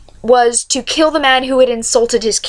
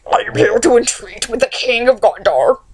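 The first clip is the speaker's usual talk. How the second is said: creepy monster herald, distorted voice; put-on villain messenger voice; Mouth of Sauron. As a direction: act a gross, creepy creature announcing itself. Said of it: "this creepy monster called the Mouth of Sauron".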